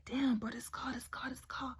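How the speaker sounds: whispered, frustrated muttering; whispered complaint. Retold or imitated. The driver staring at his broken car, whispering in dismay. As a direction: whisper it under your breath. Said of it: "he's like".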